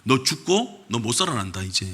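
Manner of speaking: a hint of a solemn prophet; prophet's grave message. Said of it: "그래서 선지자한테 얘기합니다".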